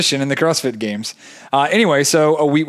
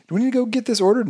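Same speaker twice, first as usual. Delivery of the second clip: high, fast mock worry; mock fretful question; 10 semitones higher, faster than usual. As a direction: ask it in a high mock fret. Mock question, pitch jumps.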